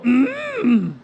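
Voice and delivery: drawn-out creature hum; Yoda. Shown as a demonstration. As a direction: demonstrate a long put-on hum. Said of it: "Can you go".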